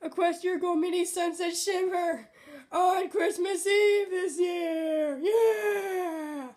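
loud, high, squealing joy, slowing right down; half-sung, cheering squeal of delight; thrilled. Real, not acted. Girl showing off her Christmas doll, squealing with joy.

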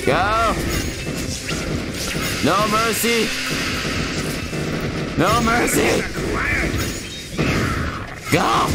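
excited battle cry. Pretend. Commanding units in game.

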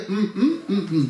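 closed-mouth "mm, mm" grunts; short hums in place of talking; wordless humming signals. Shown as a demonstration. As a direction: signal without words, lips shut. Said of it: "אני מדבר ב".

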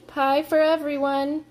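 high, flustered offer; gasped. A narrator's reading. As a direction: offer it in a nervous gasp. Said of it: "she gasped".

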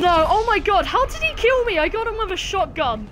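high, loud outraged yelp; raging gamer. Real, not acted. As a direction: blurt it out in disbelief. Killed in game, outraged.

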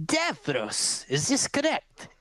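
high, crackling crone question; old crone. Acted. Crone questioning Davros at the tent entrance. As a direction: peer at him like a witch. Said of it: "she looks at you and she says".

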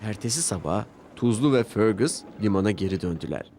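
usual speaking voice

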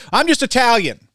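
lightly voiced Italian-style brag; hint of an Italian accent, proud; mock Italian. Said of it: "they talk like this with their hands".

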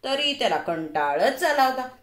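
plain reading voice

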